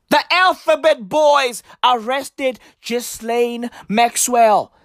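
ordinary talk

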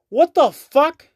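real exasperated what-the-hell; outraged exclamation. Real, not acted. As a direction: blurt it out, stunned and angry. Outraged at the litter-box request.

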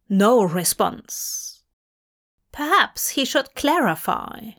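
ordinary delivery, nothing marked

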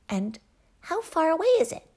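high, bouncy jester voice, eager to help; a playful fool bounding in; the court jester. A narrator's reading. Jester asks the princess.

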